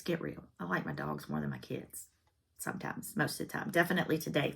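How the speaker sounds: very soft, low confession; confiding aside. Real, not acted. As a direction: drop your voice as if admitting something naughty. Confiding she prefers dogs to kids.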